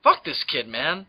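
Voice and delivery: usual speaking voice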